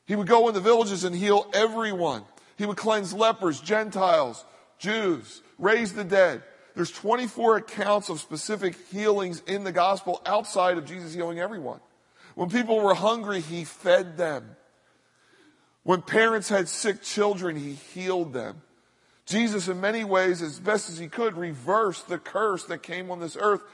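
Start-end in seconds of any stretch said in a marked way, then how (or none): none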